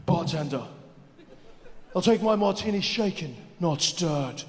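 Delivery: low, soft suave spy voice. Acted. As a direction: play it like a smooth secret agent. Spoof James Bond bar scene.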